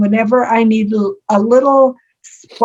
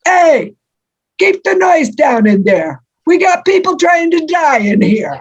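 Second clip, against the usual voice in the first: put-on New Jersey accent; 7 semitones higher than usual. Dying husband yells across the hall in New Jersey accent. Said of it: "yells in his best imitation of a New Jersey accent".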